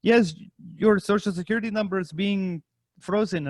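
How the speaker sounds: mock official phone scammer; imitating phone scammer. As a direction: imitate a scam caller on a cheap phone. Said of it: "he's just on a shitty track phone".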